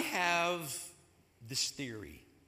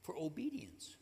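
whispered, much softer; hushed whisper; 9 dB quieter than usual. Acted. Preacher stresses obedience to the congregation, whispered. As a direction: drop to a reverent whisper.

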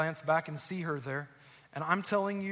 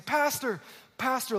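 mock calling out; 8 semitones higher, slower than usual. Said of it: "like a crazy person".

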